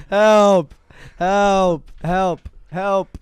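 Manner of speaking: playful shouts of help; yelling for help. Pretend. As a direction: call out for help, laughing. Mock cry for help, laughing.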